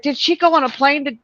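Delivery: retold alarm. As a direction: rush the question out as the worry peaks. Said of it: "I'm like".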